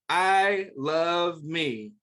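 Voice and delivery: each word said slowly and clearly; slow, deliberate call to repeat; slow model phrase. Shown as a demonstration. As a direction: spell it out slowly so children can repeat. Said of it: "If you can say it with Mister Nathan, say".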